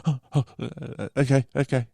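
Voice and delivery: flustered retold okay-okay; mock flustered. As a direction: imitate someone nervously giving in. Retelling the rattled driver's reply.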